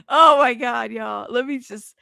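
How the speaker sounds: ordinary talk, nothing marked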